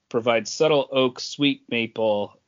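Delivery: ordinary talk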